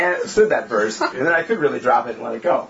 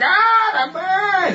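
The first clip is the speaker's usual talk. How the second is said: very high, drawn-out whine; mock whining; much slower than usual. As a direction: wail it like a toddler's tantrum. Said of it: "Whining and like".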